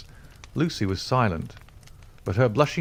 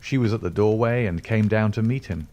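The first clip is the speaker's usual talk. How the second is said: plain reading voice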